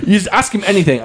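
normal talking voice

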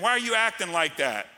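ordinary talk, nothing marked

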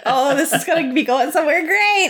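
high, eager retold reaction; mock-excited anticipation, pitched high; retold hopeful excitement. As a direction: imitate getting your hopes up. Retelling her hopeful first reaction.

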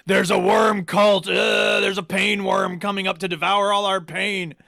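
melodramatic cultist. Retold or imitated. Passenger raving to the cab driver about a worm cult, melodramatic. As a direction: proclaim it like a hammed-up doomsayer.

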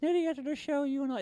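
high, soft puppet voice; flirting puppet. Acted. Puppet makes a pass at the co-host, flirting puppet voice. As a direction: make a small, high-voiced puppet advance.

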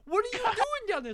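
high, loud astonished call; soul spotting a friend in hell, surprised call. Pretend. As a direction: call down in amazement.

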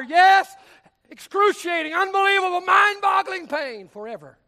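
rising to a full shout; shouted hellfire preaching. Real, not acted. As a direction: hammer each word of the torment. Preacher building hell's torment.

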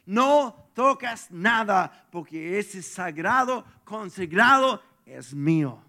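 slow, loud divine command; commanding voice of God. Acted. God forbidding Joshua to touch the spoils, loud command. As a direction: lay down the law as God. God's command about Jericho.

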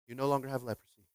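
lightly voiced Jedi master. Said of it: "like a Jedi master".

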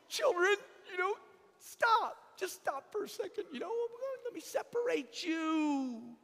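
high, strained parental call; a father halting his squabbling children; exasperated dad. Retold or imitated. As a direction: stop the kids mid-fight.

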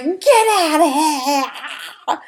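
high, slow, laughing mock yell; hammed-up bartender shooing her out; bartender telling her off. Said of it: "he's all like".

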